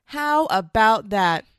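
slow, loud, drawn out; slow emphatic. Real, not acted. Mom telling husbands, slow and loud. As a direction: drag each word out for effect.

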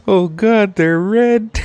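high, pained mock wail; horrified wail. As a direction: imitate a cousin's agonized discovery. Acting out hurt cousin's horrified cry.

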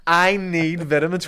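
slow, spelled-out comment line; slow demo read. Shown as a demonstration. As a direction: read it slowly for viewers. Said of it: "You need to comment under this".

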